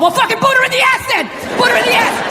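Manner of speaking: high-pitched urging from the devil on his shoulder; high, insistent inner voice; egging on. Acted. Acted argument inside his head.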